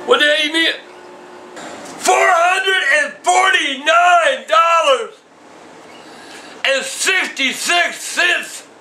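dad yelling the bill amount down the phone, slow shout. Real, not acted. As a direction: spell out the outrageous sum slowly at full volume.

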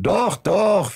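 eager protest. A narrator's reading.

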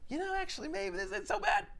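high, soft musing voice; mock reader's realization. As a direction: lightly voice a reader changing their mind.

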